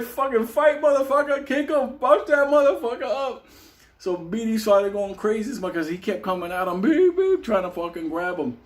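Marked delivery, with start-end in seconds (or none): 0.0-3.5 high, excited egging-on; urging a fight. Retold or imitated. 6.8-7.4 high monotone "beep" on a steady pulse; alarm clock beeping. Retold or imitated. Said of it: "like a fucking alarm clock".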